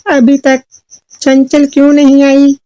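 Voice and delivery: high-pitched mother voice with pauses; questioning mother. Acted. Mother asks why her daughter is not back, high questioning voice. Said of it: "चुलबुल की माँ ने चुलबुल से".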